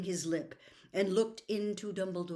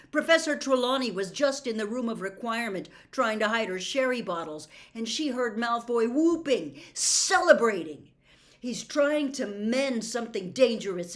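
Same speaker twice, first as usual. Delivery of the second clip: loud, higher pressing rant; heated rant; 5 semitones higher, 8 dB louder than usual. Acted. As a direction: pile it on faster. Harry's heated accusation.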